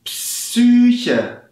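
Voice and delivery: "Psyche" said as Ps-Ü-che with a long Ü; model word. Shown as a demonstration. Teacher modelling "Psyche" with a long Ü. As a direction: say "Psyche" with a voiceless Ps then a long Ü. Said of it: "Wie ihr hört".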